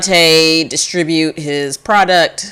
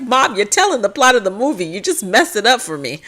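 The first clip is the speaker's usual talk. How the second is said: higher, whining at mom; whiny kid; 6 semitones higher than usual. Acted. Kid whining that mom spoiled the movie. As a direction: complain like a sulky child. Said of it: "It's like".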